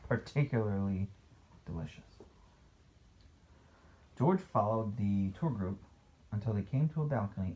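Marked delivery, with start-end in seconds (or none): none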